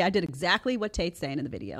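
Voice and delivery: normal talking voice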